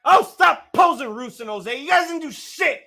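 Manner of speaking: heckling. Real, not acted. Heckling posing wrestlers.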